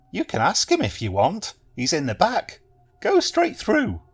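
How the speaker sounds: receptionist. A narrator's reading. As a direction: sing him through to the back. Receptionist sends Benson through.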